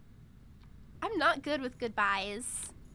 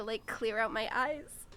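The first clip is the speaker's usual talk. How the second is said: ordinary delivery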